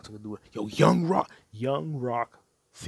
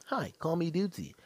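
mock AI voice; 7 semitones higher than usual. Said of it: "intelligent being named Dudesy".

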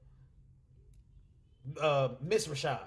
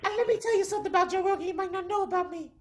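high-pitched sketch crackhead voice; Dave Chappelle; 4 dB louder than usual. Retold or imitated. Crack smoker confessing about himself, high comic character voice. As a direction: confide it in a high, put-on comic squeal. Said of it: "Dave Chappelle's version of".

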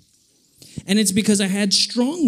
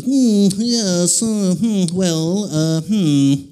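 drawn-out, deliberating medical verdict; doctor; 3 semitones higher, 3 dB louder, much slower than usual. Retold or imitated. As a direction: hum and ponder like a doctor studying an x-ray. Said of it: "and he goes".

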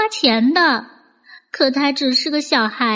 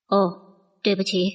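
gentle uncle voice; 6 semitones lower, faster than usual. Acted. Vendor apologizes gently.

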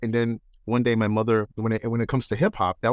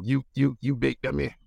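Red Foxx impression; 4 semitones higher than usual. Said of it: "from, uh, Red Fox".

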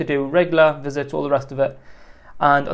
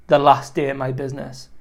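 ordinary talk

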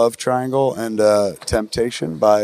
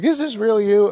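surprised fan; 9 semitones higher than usual. Retold or imitated. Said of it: "She was like".

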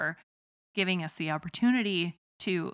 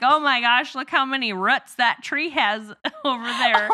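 a mock gushing exclamation, loud and high; excited small-town voice; 4 semitones higher, 6 dB louder than usual. Host acts out a Midwesterner marveling at tree roots, loud. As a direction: gush about the tree roots, hammed up.

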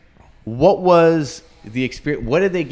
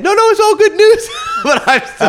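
much higher, louder, laughing it out; laughing reassurance; 5 dB louder than usual. Retold or imitated. Reassuring worried parents on the phone, laughing hard. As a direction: blurt it through laughter.

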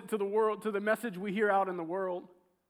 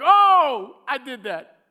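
loud bragging burst, then tossed off; a proud hero taking credit; boastful brag; 2 semitones higher, 11 dB louder, much slower than usual. Retold or imitated. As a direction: crow about the win, mocking the pride. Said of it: "And I was like".

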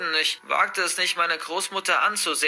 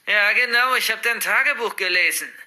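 hissed; 6 semitones higher, 5 dB louder than usual. Acted. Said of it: "zischte meine Großmutter".

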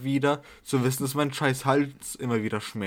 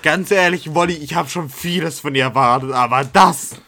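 mock outrage; 2 semitones higher, 7 dB louder than usual. Mock outrage at friend's popcorn answer.

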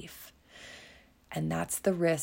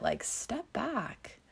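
ordinary talk, nothing marked

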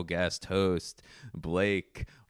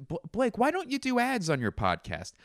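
imagined questioner; 11 semitones higher than usual. Acted. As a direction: pose the question in a high mock listener voice. Acts a listener questioning himself.

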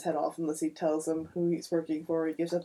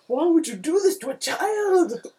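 angry Superman; 11 semitones higher, 6 dB louder than usual. Retold or imitated. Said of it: "he's like".

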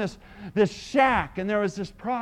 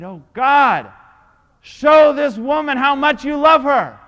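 loud fervent prayer; 5 semitones higher, 14 dB louder, slower than usual. Retold or imitated. Preacher praying aloud over the doubting woman, loud fervent cry. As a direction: cry out to God, much louder.

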